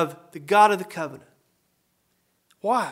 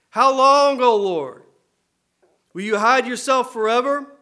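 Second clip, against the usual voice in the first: impassioned scripture reading; 3 semitones higher, 4 dB louder than usual. Acted. Preacher reading a lament psalm.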